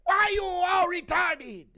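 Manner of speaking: very high, slow, stretched shout; Yoel Romero. Acted. Streamer impersonating a fighter joining Maori culture, drawn-out high shout. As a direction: holler it in a loud caricature voice. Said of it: "Impression of Yoel Romero joining Maori culture".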